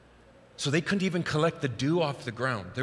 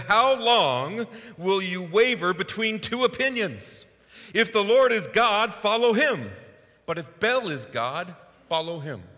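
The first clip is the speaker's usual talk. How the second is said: challenging cry; 8 semitones higher, 5 dB louder than usual. Retold or imitated. Elijah challenges the people.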